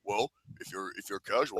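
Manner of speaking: low, soft wrestler voice; deep, hushed big guy; John Cena impression. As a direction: hesitate in a deep voice. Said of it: "impression of John Cena meeting Mister Casual".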